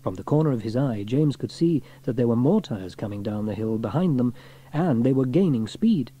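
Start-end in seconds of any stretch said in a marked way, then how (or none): none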